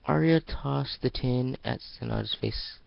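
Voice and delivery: plain reading voice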